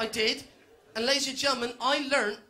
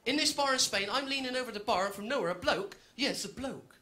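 ordinary delivery

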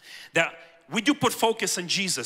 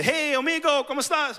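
higher, bright hello to a stranger; cheery greeting; 8 semitones higher than usual. Retold or imitated. Stan greeting the subcontractor, cheery and raised.

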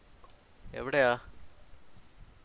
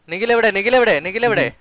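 urgent shout; 7 semitones higher, 8 dB louder than usual. Real, not acted. Searching for downed teammate.

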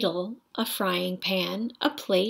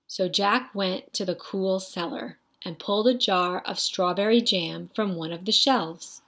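usual speaking voice

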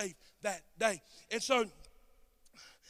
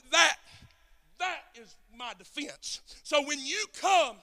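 louder, higher, drawn-out preaching; full-force preaching; 7 semitones higher, 17 dB louder, slower than usual. Real, not acted. Preacher closing the sermon to his congregation, full force. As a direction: drive the message home to the church.

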